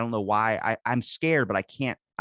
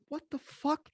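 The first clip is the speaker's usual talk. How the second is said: very high, hushed disbelief; softer, high-pitched bewildered exclamation; retold shock; 5 dB quieter than usual. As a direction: act out stunned alarm, pitch way up. Acts out shock at finding cuts.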